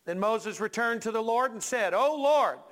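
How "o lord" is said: high, loud complaint. Acted. Preacher as Moses complaining to the Lord, ticked off. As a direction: call out in mock exasperation. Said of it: "you're, you're ticked off".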